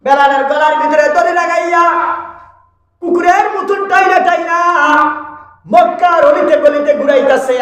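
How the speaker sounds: high-pitched, forceful sermon delivery; impassioned preaching. Real, not acted. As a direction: preach it out in a high, ringing declamation. (উঁচু সুরে জোরালো ওয়াজ)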